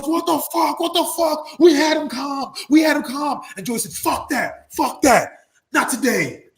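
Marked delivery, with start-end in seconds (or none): 0.0-3.4 high, agitated repeated complaint; exasperated yelling. Retold or imitated. Colleague rushing over after the brawl, exasperated yelling. Official protests at renewed fight. 3.9-6.4 higher, emphatic refusals in separate bursts; defiant. Retold or imitated. Wrestler refusing to be calmed after fighting, defiant.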